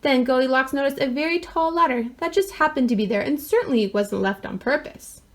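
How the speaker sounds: usual speaking voice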